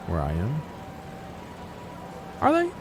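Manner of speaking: ordinary talk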